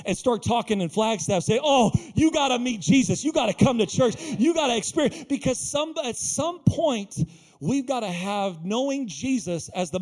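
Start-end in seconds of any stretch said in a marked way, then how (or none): 1.6-5.2 thrilled, insistent pitch; eager excitement. Acted. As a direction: urge a friend along, bubbling over. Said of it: "that excitement with Philip".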